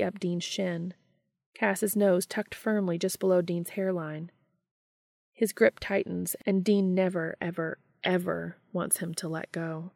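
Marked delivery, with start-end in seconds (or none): none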